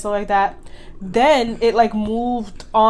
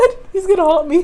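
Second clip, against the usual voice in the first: laughing fright; 8 semitones higher, 6 dB louder, much faster than usual. Retold or imitated. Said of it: "I was like".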